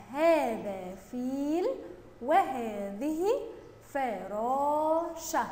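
sentence stretched word by word; slow read-along. Shown as a demonstration. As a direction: lead the class slowly with long vowels. Teacher leads pupils reading together. (قراءة جماعية بطيئة ممدودة)

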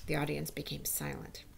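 plain reading voice

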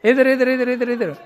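high, loud repeated call. Real, not acted. Calling the shy girl over. (ऊँची आवाज़ में बुलाना)

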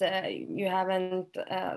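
usual speaking voice

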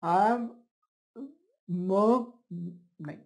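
patient who cannot phonate; dysphonia demo. Shown as a demonstration. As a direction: voice barely coming out, broken off. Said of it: "So he'll talk like this".

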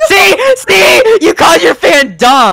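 high, loud yelling; triumphant accusing shout. Real, not acted. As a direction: yell it as proof you won the argument.

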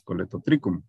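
Coletotricum con C dura; CH como C. Shown as a demonstration. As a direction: pronuncia Coletotricum con CH sonando K, no che. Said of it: "como C nada más". (Coletotricum, la CH pronunciada como C)

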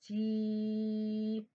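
"تي" with the ee held very long and level; very slow, sustained "تي" for pupils; strongly stretched vowel. Shown as a demonstration. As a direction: stretch "تي" right out on one note. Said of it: "مديت الصوت قوي تي".

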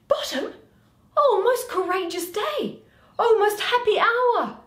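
high, loud overjoyed Quince. Acted. Quince greeting the returned Bottom, high and joyful. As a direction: cry out with joy up high.

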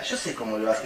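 low, hushed aside to a neighbour; mock confidential murmur; imitated audience whisperer. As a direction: say it very softly, as if to the next seat. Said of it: "le decía a la al lado".

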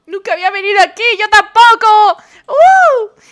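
high, loud excited cry; excited squeal. Real, not acted. Streamer reacting to the cinema date, high giddy shout.

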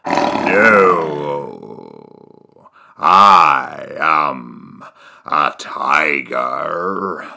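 very low, slow tiger voice; deep tiger voice. Acted. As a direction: drop deep and drag it out.

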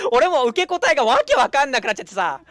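ordinary talk, nothing marked

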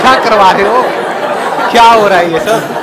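loud comic indignation; mock protest. Real, not acted. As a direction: call out in amused disbelief. Host mock-protests to the organisers.

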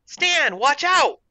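high, urgent shout of alarm; shouted warning. A narrator's reading. Warning Stan about the escaping cat, urgent shout. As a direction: yell a sudden warning, pitch jumping up.

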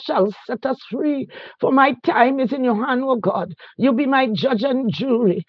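normal talking voice

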